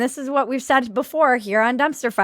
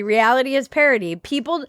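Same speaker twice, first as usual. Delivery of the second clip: normal talking voice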